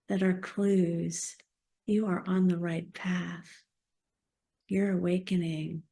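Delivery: guided meditation. Acted. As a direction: guide them gently inward, unhurried.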